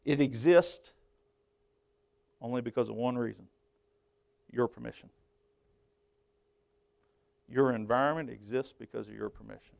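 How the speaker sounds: saying it slow. Real, not acted. Preacher giving a truth some will struggle with, saying it slow. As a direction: let each phrase land separately, quietly and deliberately. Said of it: "I'll say it slow".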